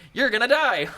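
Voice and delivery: high, loud frantic warning voice; panicked body voice. Retold or imitated. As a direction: squeal it like your body panicking. Body voiced as alarmed inner voice.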